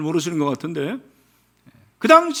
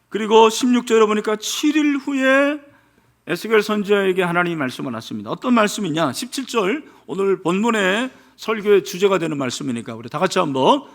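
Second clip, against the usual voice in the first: ordinary talk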